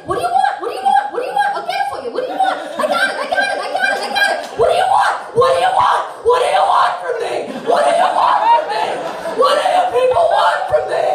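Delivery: frantic high yell rising to a scream; cracking into a yell. Retold or imitated. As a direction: break down shouting at the customers. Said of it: "So I cracked".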